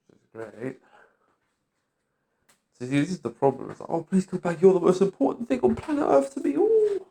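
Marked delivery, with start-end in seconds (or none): 3.9-7.0 exaggerated gushing plea, climbing higher and higher; sing-song mockery swooping up to a very high "ooh"; mock pleading. Mimicking family begging the speaker to come back, mock pleading.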